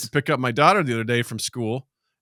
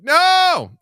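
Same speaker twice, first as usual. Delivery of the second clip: high, loud, drawn-out refusal; loud comic protest; exclaimed parental no; 6 dB louder than usual. Retold or imitated. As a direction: cry out a big mock-horrified no. Acting out a parent's exclaimed reaction.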